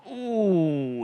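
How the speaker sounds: a put-on giant's howl; giant roar. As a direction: let out a great roar. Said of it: "he let out such a roar".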